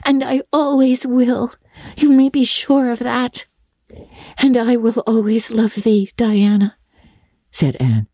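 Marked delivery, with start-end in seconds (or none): 0.0-3.5 put-on high, firm little-girl voice; staunch devotion. A narrator's reading. Diana promises lasting love to Anne, staunch. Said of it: "said Diana staunchly". 4.4-6.8 put-on higher girlish voice, solemn; solemn vow. A narrator's reading. Anne pledges love to her friend, solemn. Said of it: "solemnly extending her hand".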